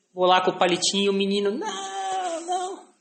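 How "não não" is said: voz aguda de criança choramingando "não"; criança recusando. Retold or imitated. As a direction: imitar o menino que se recusa a abrir a boca. (menino resistindo ao exame, choramingo agudo)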